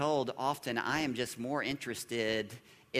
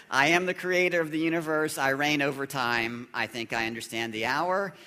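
mock Jesus voice; 3 semitones higher, 6 dB louder than usual. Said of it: "mock reply as Jesus".

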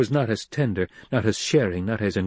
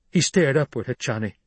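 ordinary delivery

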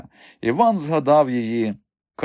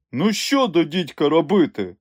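raised-pitch exasperated question; irritated; 6 semitones higher than usual. A narrator's reading. Strongman stuck for a new act, irritated. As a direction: throw it out in annoyance. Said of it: "роздратовано кинув Сила".

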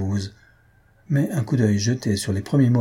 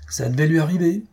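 higher-pitched, mildly worked-up remark; agitated; 7 semitones higher than usual. A narrator's reading. Father paces after the bad news, agitated. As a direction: say it with restless exasperation. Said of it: "en marchant avec agitation".

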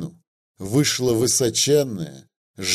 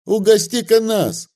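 high, loud jeering call; heckler shout; 9 semitones higher, 3 dB louder than usual. A narrator's reading. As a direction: yell it from the back rows.

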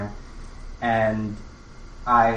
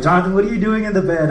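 calling friends; 9 semitones higher, 6 dB louder, faster than usual. Retold or imitated. Voicing friends calling from living room.

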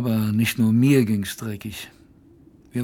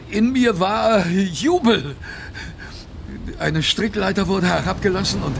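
jubilant; 6 semitones higher than usual. Acted. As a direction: savour every word of the name. Narrator's jubilant recognition of the Boss.

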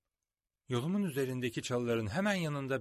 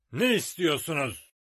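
shout; 3 semitones higher, 7 dB louder than usual. A narrator's reading. Captain calls to the rebels.

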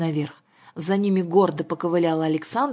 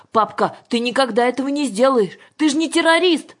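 put-on high, loud childlike wail; girl yelling; 8 semitones higher, 7 dB louder than usual. A narrator's reading. Daughter begs father not to blow it up, yelling. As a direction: cry out in high-pitched protest. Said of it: "завопила Юлька".

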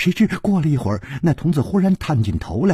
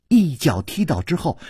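plain reading voice

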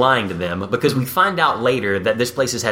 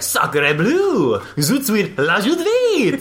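high, slow fake French phrases; fake French accent; 9 semitones higher, much slower than usual. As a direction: ham up bad French. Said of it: "saying random things like".

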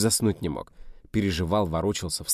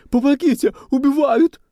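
high, loud, terrified scream for help; wailing cry for help; 12 dB louder than usual. A narrator's reading. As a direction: shriek it out as if someone is being killed. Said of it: "всплеснула руками и загласила".

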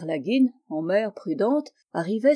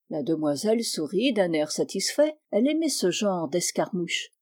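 ordinary delivery, nothing marked

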